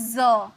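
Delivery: ज with a buzzing "z" as in xerox; ज pronounced "zo" with a z; z sound. Shown as a demonstration. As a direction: say ज as a z, not a j. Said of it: "इसे हम कहते हैं".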